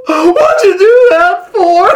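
high, slow blubbering wail; hammed-up bawling tree voice; weeping willow. A narrator's reading. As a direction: sob the words out theatrically. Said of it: "weeping willow".

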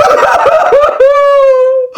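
high, loud hammed-up sobbing wail; exaggerated boo-hoo wailing; weeping wail. A narrator's reading. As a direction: bawl theatrically in a very high voice. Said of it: "weeping willow".

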